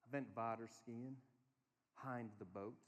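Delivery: put-on low, soft, slow German accent; roughly imitated German accent; toddler's German accent. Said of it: "he just sounded German there".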